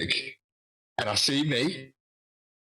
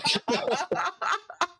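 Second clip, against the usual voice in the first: high, breathless burst of laughter; disbelieving laugh. Real, not acted. Recalling the threatening tweet, laughing in disbelief.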